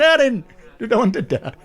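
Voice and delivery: high mock Sheffield uncle, laughing; Uncle Jack's voice. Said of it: "Uncle Jack went".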